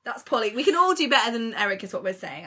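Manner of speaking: normal talking voice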